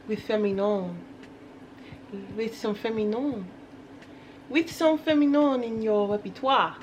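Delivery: playful put-on French accent. Vlogger announcing today's dressing topic in a mock French accent. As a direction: say it with French flair, stretching the last syllables. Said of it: "some reason I think of French".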